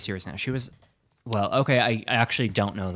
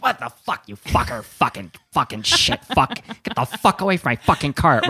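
loud mock cursing, half laughing; retold angry yelling; 3 semitones higher, 6 dB louder than usual. Homeless woman screaming at a stranger bumping her cart, mock furious. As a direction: yell it like the cart lady.

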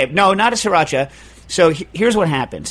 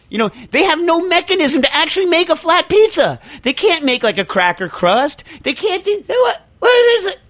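exasperated rant; 13 semitones higher than usual. Real, not acted. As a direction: rail at the absurd idea. Rant about flat pizza.

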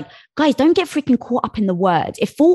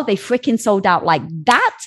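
ordinary talk

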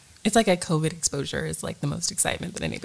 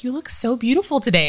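higher, louder, admiring husband voice; husband's compliment; 7 semitones higher, 5 dB louder than usual. Retold or imitated. Wife imitates husband complimenting her clothes, mock admiring. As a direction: gush it like an impressed husband. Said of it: "my husband was like".

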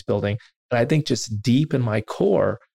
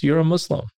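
higher-pitched quoted statement, not a question; Dalai Lama declaration; 7 semitones higher than usual. Retold or imitated. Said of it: "making a declaration".

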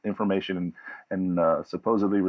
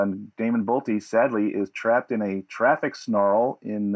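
ordinary talk, nothing marked

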